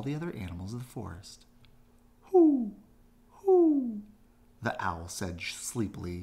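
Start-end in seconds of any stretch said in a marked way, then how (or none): 2.3-4.0 high loud hoo hoo; owl hoot. A narrator's reading. Owl waking to the commotion, loud high hoots.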